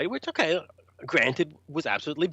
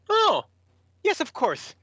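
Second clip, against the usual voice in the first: high, sarcastic "oh, of course"; mock realization; 12 semitones higher than usual. Musician told to wait for a boat, mock realization. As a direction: play at suddenly understanding something absurd.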